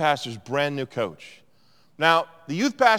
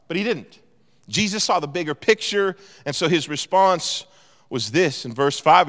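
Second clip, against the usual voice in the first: usual speaking voice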